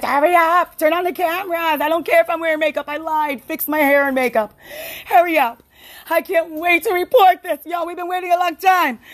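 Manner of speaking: high-pitched, breathless-to-report excitement, acted; eager urgency. Reporter acted out rushing the crew to go live, excited urgency. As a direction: play an impatient reporter bursting with news.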